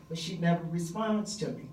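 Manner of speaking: ordinary talk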